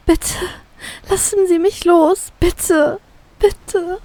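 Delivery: high, slow, faltering begging; tearful pleading. A narrator's reading. Captive begging the captain for release, tearful. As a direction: beg on the verge of tears. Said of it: "Ich beginne zu schluchzen".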